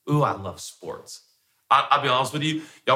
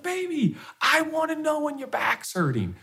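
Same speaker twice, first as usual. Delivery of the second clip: much higher, pleading loving plea; tender husband. Retold or imitated. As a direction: plead gently with your wife.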